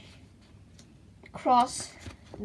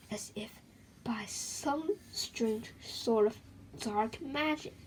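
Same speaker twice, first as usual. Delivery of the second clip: whisper; 8 dB quieter than usual. A narrator's reading. Spooky story narration.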